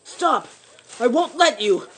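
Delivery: loud desperate cry to stop them; boy shouting at the men to spare the badger.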